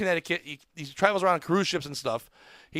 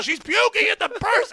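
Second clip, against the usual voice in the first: alarmed shout; 14 semitones higher, 5 dB louder than usual. Retold or imitated. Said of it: "someone's like".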